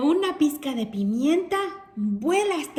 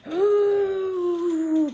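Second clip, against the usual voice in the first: angry bear grunt; 6 semitones higher than usual. A narrator's reading. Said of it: "Le hace ... porque está muy enojado". (gruñido de oso enojado)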